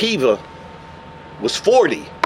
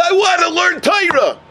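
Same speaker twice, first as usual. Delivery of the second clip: high-pitched plea without real sobbing; tearful insistence, acted; 8 semitones higher than usual. Crying son tells Eliyahu his wish, high insistent plea. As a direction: plead it high and determined. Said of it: "he's still crying".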